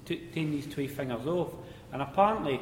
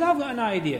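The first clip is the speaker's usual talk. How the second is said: put-on higher, louder voice of the old miner; cheeky miner voice; 10 semitones higher, 7 dB louder than usual. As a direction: deliver it like a sly pit joker.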